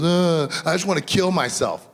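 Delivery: put-on high, loud wail of a hurt kid; upset child outburst. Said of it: "And she goes".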